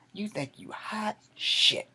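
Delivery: normal talking voice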